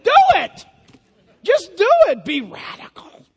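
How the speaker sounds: loud, high burst of urging; shouted command. Real, not acted. Preacher urging the congregation to act, loud shout. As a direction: bark the order to act now.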